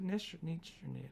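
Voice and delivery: niche tried several ways, "nish", "nitch", "neesh"; alternating pronunciations. Shown as a demonstration. Guest unsure of the word, softly testing niche as "nish" or "nitch". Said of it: "or is it niche?".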